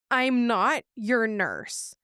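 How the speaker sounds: very slow, spaced-out words; dismissive nurse. Retold or imitated. Nurse refuses the pleading patient, slow and dismissive. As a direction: spell it out slowly to a nuisance patient.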